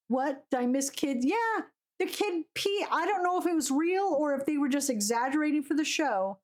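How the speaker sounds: usual speaking voice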